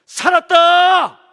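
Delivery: drawn-out, high, full-volume shout; korean military style. Shown as a demonstration. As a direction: yell it like a drill sergeant. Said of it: "그래가 힘이 나겠어요?".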